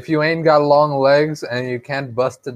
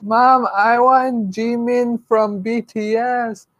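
put-on high, slow begging child; whiny kid voice; 10 semitones higher, slower than usual. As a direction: whine it like a spoiled kid. Kid whining to mom.

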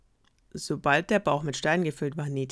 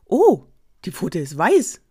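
put-on excited kid; 3 semitones higher, 9 dB louder, slower than usual. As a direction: burst out with delight. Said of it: "rief ein Geißlein begeistert".

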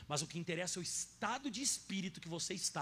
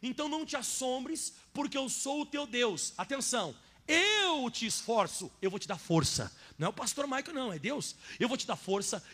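loud, higher, slowed emphatic preaching; preaching at full force; 4 semitones higher, 7 dB louder than usual. Acted. Pastor to congregation, loud fervent preaching. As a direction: hammer each promise home.

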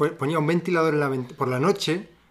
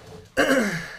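throat clearing; 11 semitones higher than usual. Real, not acted. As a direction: clear your throat sharply. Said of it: "Toso, pido ya perdón".

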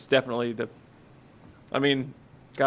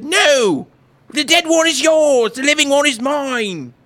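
much higher and louder, hammed-up British woman; insistent mother; 11 semitones higher, 5 dB louder, slower than usual. The first mother arguing back before the king, indignant.

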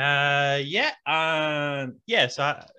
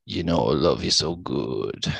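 deep low crooning; 10 semitones lower than usual. Retold or imitated.

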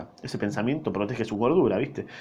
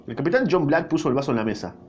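ordinary delivery, nothing marked

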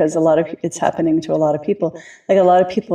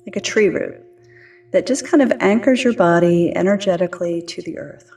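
calm grounding exercise. Acted. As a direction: ease them gently into their bodies, unhurried. Leads a grounding meditation.

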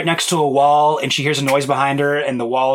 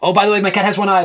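quick warning; 6 semitones higher, faster than usual. Retold or imitated. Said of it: "like, warn people".

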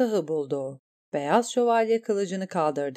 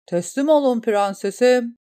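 higher, louder call to surrender; 5 semitones higher, 6 dB louder than usual. A narrator's reading. The commander calls on the princess to surrender, loud. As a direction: call across the water commandingly. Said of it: "diye seslendi kumandan".

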